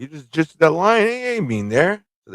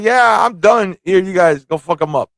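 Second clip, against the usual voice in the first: offhand He-Man; 6 semitones higher, 4 dB louder than usual. Acted. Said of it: "He-Man's like".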